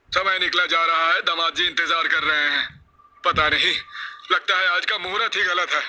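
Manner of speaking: urgent ordering. Acted. Elder sends mother to ready the bride, urgent.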